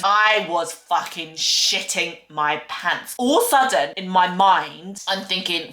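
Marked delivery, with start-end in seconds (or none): none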